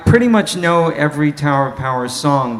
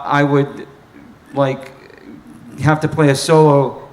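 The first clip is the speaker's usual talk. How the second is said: ordinary talk, nothing marked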